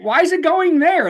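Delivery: high-pitched, incredulous retelling; mock baffled question. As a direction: act out an alarmed patient's question. Acts out his baffled question to staff.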